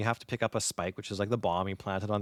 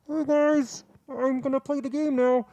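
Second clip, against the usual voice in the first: high, loud nasal-free mock gamer greeting; goofy gamer voice; 6 dB louder, slower than usual. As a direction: play the clueless kid on voice chat, pitched way up. Mimicking a basic headset gamer.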